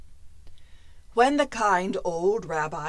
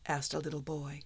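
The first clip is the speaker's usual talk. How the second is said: ordinary delivery, nothing marked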